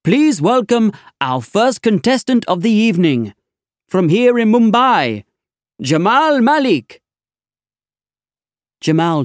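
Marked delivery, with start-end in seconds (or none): 0.0-7.0 game show host. Acted. Quiz host welcoming the studio audience, big high showman voice. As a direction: announce it like a TV host.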